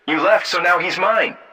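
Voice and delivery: high-pitched, insistent woman. A narrator's reading. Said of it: "Jenna insisted".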